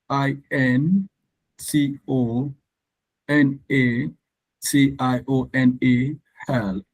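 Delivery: normal talking voice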